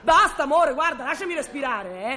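wife shouting down her husband's supposed jealousy, indignant; angry outburst. Acted. Wife escalating jealous-quarrel accusations.

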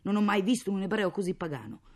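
loud, high-pitched irritated outburst; angry. Acted. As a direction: rant bitterly about a useless husband. Angry mother ranting about absent husband. (sfogo arrabbiato)

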